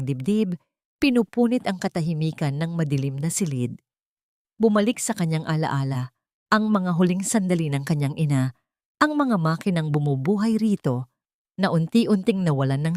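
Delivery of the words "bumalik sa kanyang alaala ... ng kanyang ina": ordinary delivery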